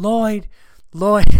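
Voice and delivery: high, loud acted call; screaming for dog. Owner chasing runaway dog through streets, screaming his name.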